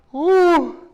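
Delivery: loud high whoop. Real, not acted. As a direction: let out a triumphant woo. Whoop after a set.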